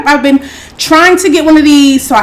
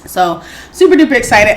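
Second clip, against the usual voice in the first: ordinary talk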